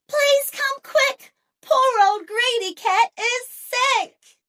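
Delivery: put-on high, loud anxious child's call; girl calling dad. A narrator's reading. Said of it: "Katy called to her dad".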